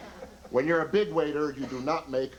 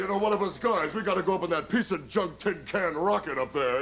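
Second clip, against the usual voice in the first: lightly voiced old-timer comic, a touch higher; grumbling old vaudeville comic; old comedian; 4 semitones higher than usual. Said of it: "It goes something like this".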